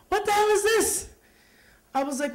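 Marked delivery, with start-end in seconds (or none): 0.1-1.1 high, raised indignant exclamation; acted outburst from an appalled father; father's outraged shock. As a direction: demand it in scandalized disbelief, voice shooting up.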